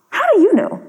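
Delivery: high, pointed question from the master; stern teacher pressing the children for proof; sharp question. Acted.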